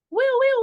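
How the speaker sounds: high, loud siren wail woo; loud playful siren noise; mock siren. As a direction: imitate a police siren, way up high. Imitating police siren behind her.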